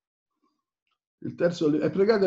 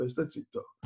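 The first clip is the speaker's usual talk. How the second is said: hushed self-warning; 6 semitones lower, 13 dB quieter than usual. Retold or imitated. Preacher quoting his own old fear of speaking, hushed. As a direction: lower and much softer, almost under the breath. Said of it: "perché io fino a più poco fa dicevo".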